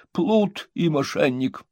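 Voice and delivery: high, slow self-admiring reading; pompous reading. A narrator's reading. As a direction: savour every grand word. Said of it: "выразительностью его чтения".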